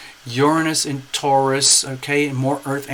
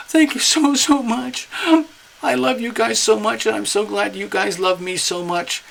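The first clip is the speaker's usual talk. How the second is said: much higher, gushing, overwhelmed voice; gushing tearful thanks; 7 semitones higher than usual. Pretend. Cancer thanking friends who brought the party, high gushing thanks. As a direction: pour out grateful thanks like a teary Cancer. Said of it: "Pretend ... crying his eyes out".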